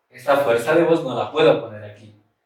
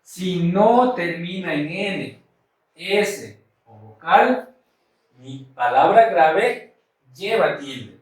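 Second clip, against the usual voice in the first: slow, word by word, for note-taking; slow dictation; slower than usual. Shown as a demonstration. Teacher dictating the rule for students to copy, slow. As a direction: dictate it slowly so they can write. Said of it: "aquí voy a anotar".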